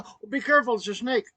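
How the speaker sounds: high, urgent retold warning; imitated alarmed warning. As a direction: act out her scared warning. Wife warns him of snake.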